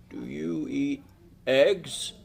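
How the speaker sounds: lightly voiced frail old man, soft; a hint of an old man checking his list; mild old man voice quizzing his guest; slow old man. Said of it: "He talks slow".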